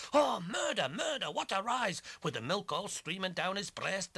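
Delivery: high loud exclamation; crying out. Acted. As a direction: shout it in alarm. Crying out at the spill.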